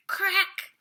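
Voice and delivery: loud, high, sudden crack; sharp crack of a robot hitting rock; sharp crack sound. A narrator's reading. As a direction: spit out the crack like metal striking stone. Climbing cliffs, hitting rock.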